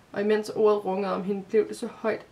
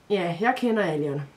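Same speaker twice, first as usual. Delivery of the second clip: firm, louder confirmation; hard; 4 dB louder than usual. A narrator's reading. Boy confirms he knows the person, hard. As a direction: say it with a hard edge. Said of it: "sagde han hårdt".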